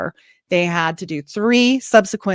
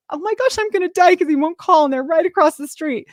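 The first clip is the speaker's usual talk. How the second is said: mock panic; 10 semitones higher than usual. Husband won't call 911.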